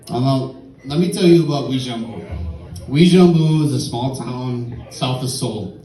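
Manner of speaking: normal talking voice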